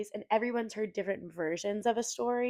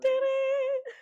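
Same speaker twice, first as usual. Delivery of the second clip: mock exclamation; 7 dB louder than usual. Mock outburst over scene.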